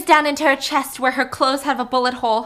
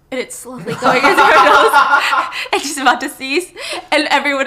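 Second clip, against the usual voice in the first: laughing; 4 semitones higher, 9 dB louder than usual. Real, not acted. Host laughing while telling the hot sauce scene.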